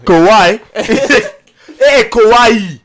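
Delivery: slow, high mock African accent call. Teasing friends, imitating Ujiri in an African accent. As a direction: call Kawhi slowly in a put-on African accent. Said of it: "There you go".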